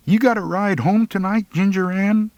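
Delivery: put-on a hesitant teen trying his luck; tentative, high. A narrator's reading. Boy nervously asking Ginger Ann for a ride, high and tentative. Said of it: "I ventured".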